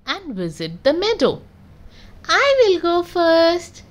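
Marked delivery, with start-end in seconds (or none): 2.3-3.8 high, slow little goat; a small kid goat piping up; squeaky young goat, slow. A narrator's reading. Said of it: "says Little Billy Goat".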